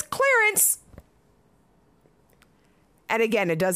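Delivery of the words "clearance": higher, punched-out label read; punchline reveal. Pretend. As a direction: land the word like a punchline. Reading the box label for punch.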